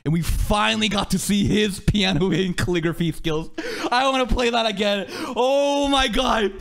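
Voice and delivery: normal talking voice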